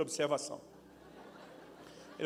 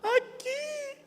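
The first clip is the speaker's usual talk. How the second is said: put-on child's voice; 3 dB louder than usual.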